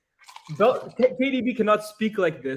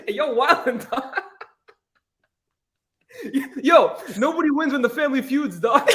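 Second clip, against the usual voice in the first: much higher, laughing mock calm-down voice; mock peacemaker Lukaku; 10 semitones higher, 3 dB louder than usual. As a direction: play the teammate stepping in, half laughing. Imitating Lukaku calming teammates.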